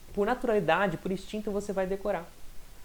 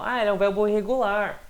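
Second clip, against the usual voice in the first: higher-pitched, sing-out quote; imitated remark; 4 semitones higher, 4 dB louder than usual. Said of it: "Quando eu falo".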